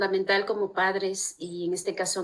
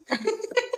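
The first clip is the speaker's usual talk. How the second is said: high laugh; 12 semitones higher, 5 dB quieter than usual. Real, not acted. Laugh after the suitcase story.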